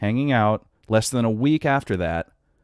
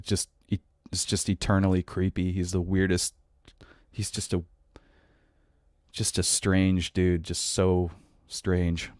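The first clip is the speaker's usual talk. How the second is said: hushed aside; 4 semitones lower, 6 dB quieter than usual. Real, not acted. Host baffled by Elon Musk, near-whispered aside.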